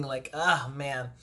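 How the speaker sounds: ordinary delivery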